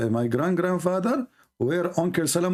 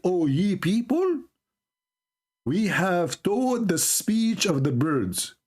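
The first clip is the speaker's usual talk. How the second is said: slow, higher-pitched royal proclamation; proclaiming Solomon; 5 semitones higher, slower than usual. Acted. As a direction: address the people in drawn-out, lofty phrases. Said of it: "He said".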